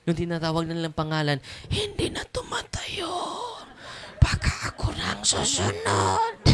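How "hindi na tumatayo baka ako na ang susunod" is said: very high, breathy frightened whisper; scared whisper. Retold or imitated. A frightened visitor whispering to others, very high and scared. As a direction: whisper it like someone terrified they are next.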